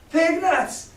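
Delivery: high incredulous shriek; scream. Acted. Mary screaming Silver's taunt back at him, high. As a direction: spit it back in a shrill fury. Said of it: "repeated Mary in a scream".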